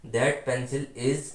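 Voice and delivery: slow dictation. Shown as a demonstration. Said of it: "okay?".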